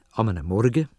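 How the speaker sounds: ordinary delivery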